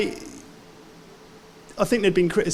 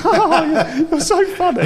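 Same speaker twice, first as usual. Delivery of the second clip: high, laughing mock girl voice; 11 semitones higher, 5 dB louder than usual. Young baker laughing at his question, high and giggly. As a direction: giggle the words out, high and bright. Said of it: "she laughed, went".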